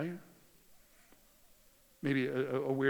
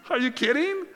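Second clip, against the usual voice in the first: incredulous; 11 semitones higher, 7 dB louder than usual. Retold or imitated. Retold disbelief at the cop.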